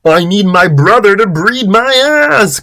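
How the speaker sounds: high, hammed-up yell; Chris. A narrator's reading. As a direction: wail it in a high put-on voice. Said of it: "Chris yelled".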